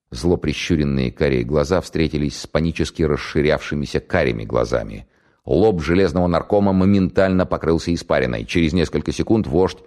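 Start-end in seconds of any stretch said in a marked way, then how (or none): none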